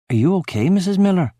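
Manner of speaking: mildly anxious question, rising high on the name. A narrator's reading. Boy to the tearful neighbour, anxiously. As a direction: ask with careful worry, lifting the end. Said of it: "I ask, anxiously".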